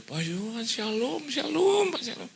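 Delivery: high, eager gushing hello; excited greeting. Retold or imitated. Young couple greeting their pastor with high eager excitement. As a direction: gush the greeting like a thrilled fan.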